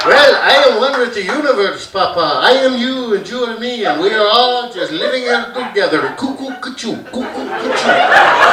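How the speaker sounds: lilting mock Indian accent. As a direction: serene Indian-accented son, ending in a silly chant.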